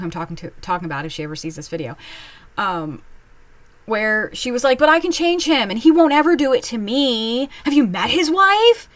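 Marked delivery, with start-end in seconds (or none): 4.8-8.9 high, louder mock of a smitten friend; mocking naive friend. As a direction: squeal it like a friend sure she can fix him. Friend insisting she'll change him.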